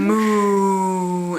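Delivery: long lowing moo; cow moo. Shown as a demonstration. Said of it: "what does the cow say".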